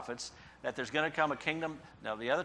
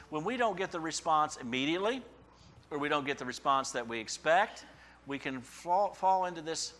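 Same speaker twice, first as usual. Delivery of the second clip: ordinary talk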